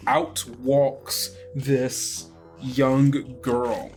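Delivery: normal talking voice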